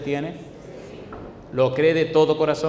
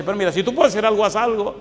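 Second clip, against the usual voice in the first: pleading father, faster and louder; desperate father; 5 semitones higher, 4 dB louder than usual. Retold or imitated. The father begs Jesus to help his son, desperately pleading. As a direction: beg like a frantic father. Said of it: "el papá desesperado dice".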